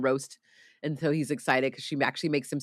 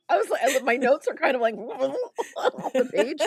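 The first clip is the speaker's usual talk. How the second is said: speaking through real laughter, high; laughing while explaining; 11 semitones higher than usual. Real, not acted. Host laughing about her notes, laughing through words.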